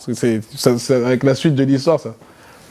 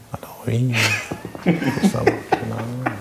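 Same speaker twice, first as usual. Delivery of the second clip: soft slow imitation; 5 semitones lower, 6 dB quieter, slower than usual. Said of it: "il parle très lentement".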